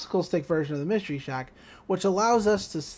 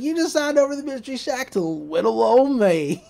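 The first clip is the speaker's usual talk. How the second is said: put-on higher, louder gloating villain kid; gloating Gideon; 5 semitones higher, 5 dB louder than usual. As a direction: crow over the trick. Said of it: "He's like".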